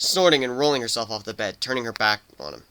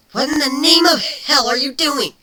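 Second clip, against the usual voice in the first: indignant shout; 8 dB louder than usual. Acted. Changeling shocked by the hug.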